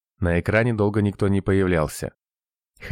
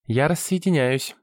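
high-pitched, official announcement; girl operator; 7 semitones higher than usual. A narrator's reading. Operator ends the call, firmly announcing. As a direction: declare it in a high girl's voice. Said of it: "заявила девушка из земли".

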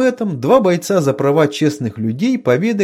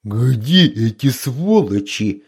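roar; 3 semitones lower, slower than usual. A narrator's reading. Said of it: "взревел Гнездо".

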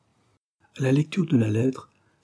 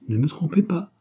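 higher-pitched, anxious plea; understated tremulous appeal, pitched up; trembling but firming; 7 semitones higher than usual. A narrator's reading. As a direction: plead with a voice gathering firmness. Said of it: "dont la voix tremblante s'affermissait cependant d'une singulière énergie".

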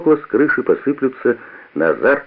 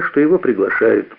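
ordinary delivery, nothing marked

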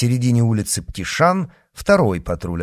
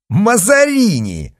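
high, loud, drawn-out exclamation; gleeful call; 12 semitones higher, 8 dB louder, slower than usual. A narrator's reading. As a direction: call the name out high and slow, as a joke. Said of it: "с хохотом ответил Д'Артаньян".

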